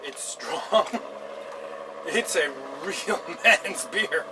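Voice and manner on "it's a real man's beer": slow words through laughter; chuckling as he speaks; laughing. Real, not acted. Laughing at the strong beer.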